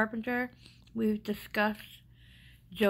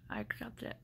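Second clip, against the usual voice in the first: soft, lower, barely voiced give-in; resigned quiet acceptance; 11 semitones lower, 6 dB quieter than usual. Telling the clinic staff she gives up, in a soft resigned near-whisper. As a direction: let it go almost under your breath, defeated. Tearful resignation after bursting into tears.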